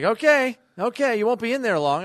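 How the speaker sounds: high, loud knowing dad; amused dad voice. Retold or imitated. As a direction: tease like a dad who knows.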